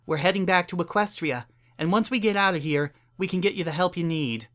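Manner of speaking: a caring mare coaxing a stranger; kind Earth Pony mayor. A narrator's reading.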